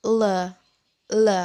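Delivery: "le" as a short "luh"; L sound. Shown as a demonstration. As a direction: say "le" with tongue tip between palate and teeth. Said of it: "ujung lidah ditaruh di atas langit-langit". (bunyi "le", ujung lidah di antara langit-langit dan gigi)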